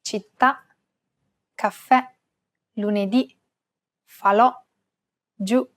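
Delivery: Città, caffè, lunedì, falò, giù, each stressed on the last vowel; final-syllable stress. Shown as a demonstration. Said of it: "just stress that syllable".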